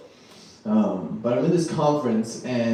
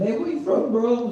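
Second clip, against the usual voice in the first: much higher, breezy mock-friendly bro; casual bro greeting; 10 semitones higher than usual. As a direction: chat him up like a cheerful stranger. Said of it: "I go to a guy and".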